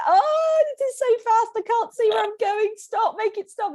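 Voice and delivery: very high, hammed-up terrified wail; crying panic. Stoker retelling her first tandem descent, wailing in panic. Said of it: "I cried. I was like".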